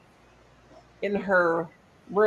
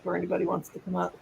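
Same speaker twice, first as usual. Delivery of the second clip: ordinary delivery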